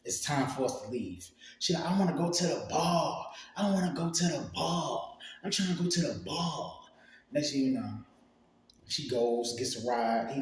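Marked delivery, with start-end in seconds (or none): 1.9-6.8 high, insistent mimicked plea; imitating drunk girlfriend. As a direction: keep demanding it in her higher voice, same tune each time. Drunk girlfriend's repeated demand imitated.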